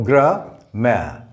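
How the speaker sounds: slow "gra-ma", schwa then open A; split syllables. Shown as a demonstration. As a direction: sound out "gra-ma" in two separate beats. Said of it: "The sound here changes".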